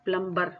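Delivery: "प्लम्बर" with an audible B after the M; B pronounced. Shown as a demonstration. Teacher shows the common mistake, "प्लम्बर" with the B voiced. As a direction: sound the B in "प्लम्बर". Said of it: "ये प्लम्बर नहीं होता".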